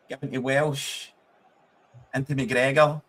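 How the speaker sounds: normal talking voice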